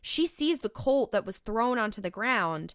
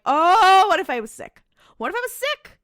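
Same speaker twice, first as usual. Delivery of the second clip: mock disgusted reaction; 9 semitones higher, 7 dB louder than usual. Host reacting to a creepy angel joke, mock dismay. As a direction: exaggerate your revolted reaction.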